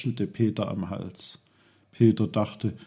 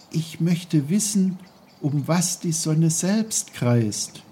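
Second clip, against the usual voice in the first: put-on higher-pitched, wondering boy; Peter, wondering child; 6 semitones higher, 3 dB louder than usual. Boy muses alone about the sun's path, wondering. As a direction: wonder it aloud as a curious boy.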